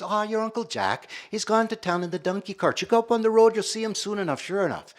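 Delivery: high, lilting voice of an imitated Irish relative; chatty Irish cousin. Irish cousin sends visitors up the road to Uncle Jack, high and chatty. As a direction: play the Irish cousin, pitched well up.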